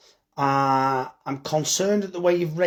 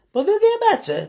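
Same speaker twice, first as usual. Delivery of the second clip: much higher and louder, sing-song; sudden squeaky reassurance; 6 dB louder than usual. Real, not acted. Butcher assures viewers vegans get better, high chirpy voice.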